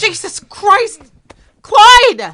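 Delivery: high, loud cry of alarm; sudden startled scream; shocked shout. Acted. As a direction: yell it out in shock. Wife shocked at the scene.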